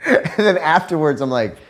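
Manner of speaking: ordinary talk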